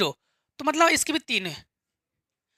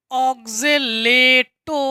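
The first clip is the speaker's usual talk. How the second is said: very slow, syllable-by-syllable term; drawn-out dictation; 4 dB louder, much slower than usual. Shown as a demonstration. Teacher spelling out a ligand name for students, drawn-out dictation. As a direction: stretch each syllable while writing.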